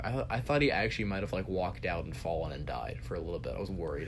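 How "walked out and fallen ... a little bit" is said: usual speaking voice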